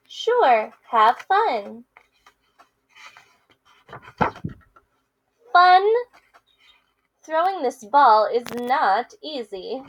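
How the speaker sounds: lively picture-book read-aloud with character voices; reading to children. Acted. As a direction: read it to kids, playing both friends. Teacher reads picture book aloud.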